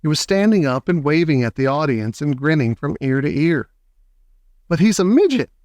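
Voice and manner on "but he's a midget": higher, shocked shout; father shouting in dismay. A narrator's reading. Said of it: "shouted Mister T V".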